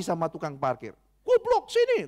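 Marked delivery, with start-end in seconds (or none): 1.3-2.1 shouted insult much higher and louder; attendant's insult. Retold or imitated. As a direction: hurl it like a rude attendant. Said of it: "Tuh, tukang parkirnya begitu". (makian kasar, teriak tinggi)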